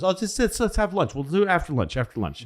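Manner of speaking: high, airy put-off, brushing him aside; breezy dismissive FDR. Retold or imitated. FDR waving off Winston, high and breezy. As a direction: float it up high like you can't be bothered. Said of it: "F D R goes".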